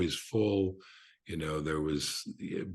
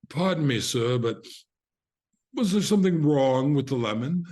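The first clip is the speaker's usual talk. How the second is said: high, loud snooty French waiter; haughty French accent; 8 semitones higher, 5 dB louder than usual. Retold or imitated. Waiter to a diner who overheard praise, haughty French accent. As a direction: put on a grand French accent, pitched up. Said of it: "best haughty French accent".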